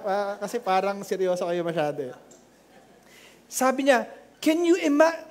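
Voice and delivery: ordinary delivery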